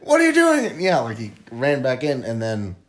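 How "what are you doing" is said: high, loud startled shout; re-enacted alarm, pitched way up; mock panicked yelling. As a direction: yelp it as if jolted awake. Acts out everyone waking in alarm.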